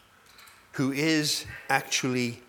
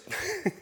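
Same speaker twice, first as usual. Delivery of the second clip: a quick high laugh; a burst of amused laughter; short laugh; 14 semitones higher, 6 dB quieter than usual. Real, not acted. Preacher laughing at how complicated the passage is, a brief high laugh.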